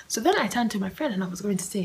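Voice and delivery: usual speaking voice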